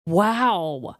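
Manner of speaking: ordinary talk, nothing marked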